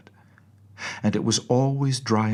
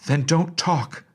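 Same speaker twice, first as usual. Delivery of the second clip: higher, louder short reply; curt nurse; 8 semitones higher, 6 dB louder than usual. A narrator's reading. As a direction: cut her off in a hurry. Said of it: "said Looty".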